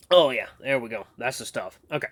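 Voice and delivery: ordinary delivery, nothing marked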